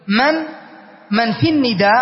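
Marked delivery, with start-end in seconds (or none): none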